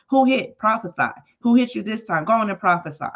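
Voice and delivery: mock him like a cruel guard; sarcastic taunting. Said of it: "'cause they were being sarcastic".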